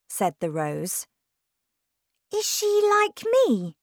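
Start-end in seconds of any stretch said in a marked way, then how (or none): 2.3-3.8 very high, eager question; excited girl's question, pitched way up. Acted. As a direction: ask it with bubbling excitement. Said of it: "asked Alice, excitedly".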